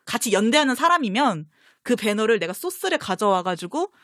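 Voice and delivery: normal talking voice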